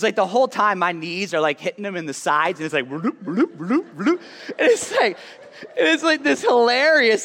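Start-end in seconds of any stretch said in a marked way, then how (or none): 2.9-4.2 bloop noises. Retold or imitated. Knees hitting brother's back. 4.6-7.2 laughing. Real, not acted. Laughing at the bike memory.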